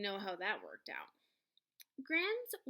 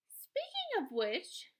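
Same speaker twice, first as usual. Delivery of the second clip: slow, higher mom opener; Mom voiced; 10 semitones higher, slower than usual. A narrator's reading. Mom turning the talk to her son's school, slow and higher. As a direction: draw it out, a touch pointed. Mom quizzing son in the car.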